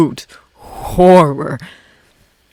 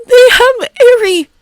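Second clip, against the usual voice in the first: louder, higher panicked wail; panicked cry; 7 dB louder, slower than usual. Acted. Canary panicking over Eri, wailing louder. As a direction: repeat it with rising horror.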